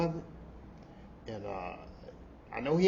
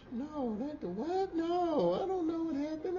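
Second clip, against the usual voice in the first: playing dumb; 12 semitones higher, 4 dB quieter than usual. Playing dumb to defend him.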